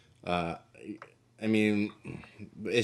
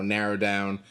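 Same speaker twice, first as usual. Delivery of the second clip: normal talking voice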